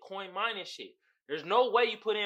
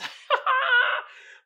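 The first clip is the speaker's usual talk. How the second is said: loud, high shouted laugh; loud shouted burst of laughter; 8 dB louder than usual. Real, not acted. Laughing at scam meme.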